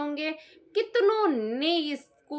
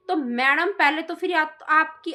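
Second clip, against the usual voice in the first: usual speaking voice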